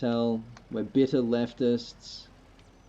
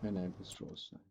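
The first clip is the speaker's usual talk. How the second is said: whispered; 5 semitones lower, 16 dB quieter than usual. Shown as a demonstration. Repeating the game option quietly.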